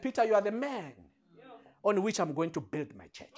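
softly spoken, low solemn pronouncement; solemn quote. Acted. Christ naming Peter the rock of his church, solemn and quiet.

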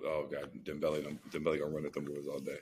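ordinary delivery

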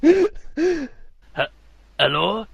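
hammed-up French caller; French accent. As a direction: answer with a loud, exaggerated French accent. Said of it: "the Frenchman".

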